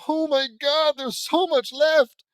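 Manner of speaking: loud, high cry of dismay; exasperated outburst. Real, not acted. Reader groaning at how much is left, exasperated outburst. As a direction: wail it out, drawn out and loud.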